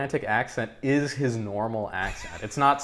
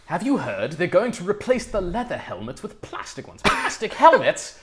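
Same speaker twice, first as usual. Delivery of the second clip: scandalised mock-British gent, rising higher; British accent; 6 semitones higher than usual. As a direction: put on an appalled British accent. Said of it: "So have a British person".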